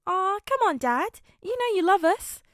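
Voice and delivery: put-on high, loud, sing-song little girl; little daughter teasing her grumpy dad, high and bright; cute child voice. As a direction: coax him like a sweet kid.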